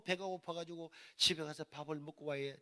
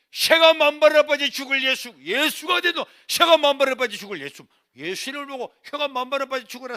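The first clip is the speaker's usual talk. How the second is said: high bellowed cursing; repeated loud dialect curse, ranting; cursing mother; 8 semitones higher, 18 dB louder than usual. Retold or imitated. Mother cursing Jesus as son leaves, furious shouting. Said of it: "하나님을 보고 욕을 하는 거야".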